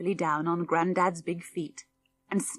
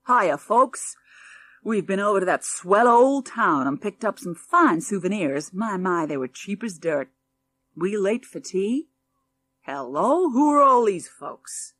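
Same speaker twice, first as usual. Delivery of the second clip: put-on American accent; 4 dB louder than usual. Burly American father.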